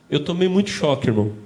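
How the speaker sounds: normal talking voice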